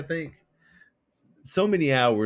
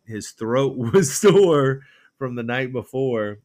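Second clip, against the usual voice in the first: laughing; 7 dB louder, slower than usual. Real, not acted. Laughing while retelling prank.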